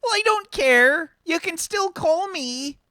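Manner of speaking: high, loud, slow mum scolding; nagging mum. Acted. Mum arguing with Arthur about phone calls, high and loud. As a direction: brush off his excuse like a stubborn mother.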